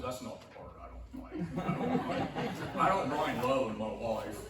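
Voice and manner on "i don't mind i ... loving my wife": loud protest. Real, not acted. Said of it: "insisting he loves his wife".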